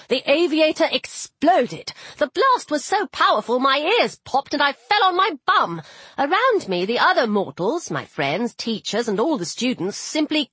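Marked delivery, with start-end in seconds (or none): none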